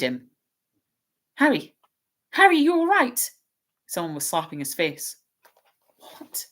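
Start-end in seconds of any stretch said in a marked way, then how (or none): 1.4-3.3 high, loud urgent call of his name; anxious calling. Acted. Friend calling to fallen Harry. 6.0-6.5 faint whispered question on waking; dazed whisper. Acted. Harry coming round, dazed.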